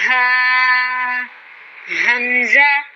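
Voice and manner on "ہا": ordinary talk, nothing marked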